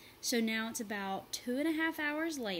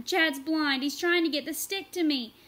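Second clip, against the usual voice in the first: loud, higher call; 6 semitones higher, 9 dB louder than usual. A narrator's reading. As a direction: shout the news across. Tamaya shouting from the mud.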